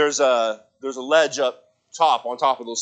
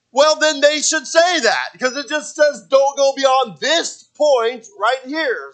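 high, louder whiny protest, last words slow; mock indignant self; 11 semitones higher, 5 dB louder, slower than usual. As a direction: argue the sign's wording like a stubborn kid. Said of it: "I'm like".

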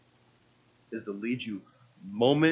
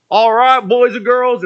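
loud, high cowboy stage voice; weird character voice; 10 semitones higher, 10 dB louder, slower than usual. Retold or imitated. Actor back in character addressing kids, loud and high. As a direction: belt it out as a hammed-up kids' play cowboy. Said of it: "that weird voice that I can't do".

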